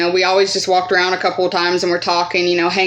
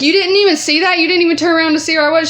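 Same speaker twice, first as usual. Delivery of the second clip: indignant disbelief; 8 semitones higher, 3 dB louder, faster than usual. Retold or imitated. Narrator confronts inattentive friend.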